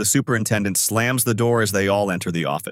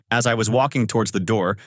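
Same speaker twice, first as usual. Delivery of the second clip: usual speaking voice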